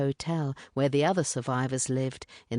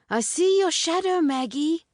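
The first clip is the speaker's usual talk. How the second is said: much higher teasing call; 14 semitones higher, 4 dB louder than usual. A narrator's reading. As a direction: call to the hiding girl. Calling to hiding girl.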